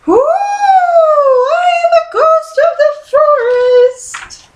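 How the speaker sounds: very high, slow, drawn-out ghost wail; spookier ghost moan. Pretend. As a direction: wail it long and eerie. Jane playing bigger ghost.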